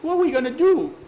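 high fearful cry; scared. Acted. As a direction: ask it in a panic. Scared boy facing the unknown.

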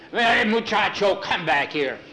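urgent storyteller's holler; calling back. Acted. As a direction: call after someone walking off. Calls the one leaving back.

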